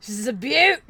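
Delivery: admiring mock-Australian wildlife host; Steve Irwin. Host admiring a wild specimen, playful Australian accent. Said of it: "like having Steve Irwin drive me".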